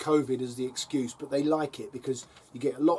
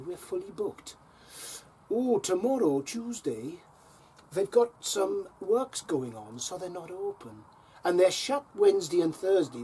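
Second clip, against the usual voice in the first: imitating a chatty Welsh accent, sing-song; Welsh lady accent; 4 semitones higher than usual. Council receptionist booking a tip slot by phone, imitated Welsh accent. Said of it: "my attempt at the Welsh accent".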